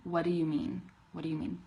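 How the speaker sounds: "what do you mean" blended as "whaddaya mean"; combined quickly. Shown as a demonstration. Teacher combining the pieces for learners, linked. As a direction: run the words together casually. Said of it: "combine it all quickly".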